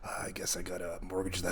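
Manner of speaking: muttered under breath; whisper. Acted. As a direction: whisper it in defeat. Losing poker hand, muttered aside.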